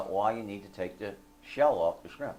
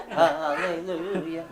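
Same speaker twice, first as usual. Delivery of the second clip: slow, loud, high hallelujah; mock rejoicing; 5 semitones higher, 5 dB louder, slower than usual. Thinking of eating dry cake again, mock rejoicing. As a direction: stretch it out in sarcastic celebration.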